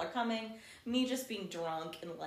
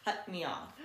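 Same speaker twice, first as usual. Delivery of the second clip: ordinary delivery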